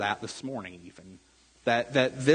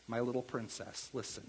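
soft, hushed, rushed fatherly coo; mock gentle; 3 semitones lower, 15 dB quieter, faster than usual. Pastor imitating himself cooing to his daughter, soft and quick. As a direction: coo it sweetly to a child, then rush on. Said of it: "I don't start quite as gently".

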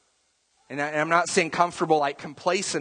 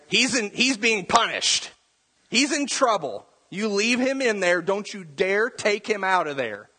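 higher, firm motherly scolding; stern mom; 6 semitones higher than usual. Retold or imitated. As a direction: lay down the law as the mom. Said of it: "the mom peeks around the corner and is like".

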